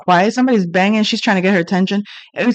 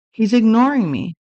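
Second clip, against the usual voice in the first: normal talking voice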